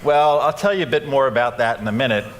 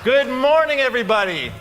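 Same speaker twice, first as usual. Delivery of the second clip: enthusiastic greeting; 11 semitones higher than usual. Real, not acted. Greeting a crowd.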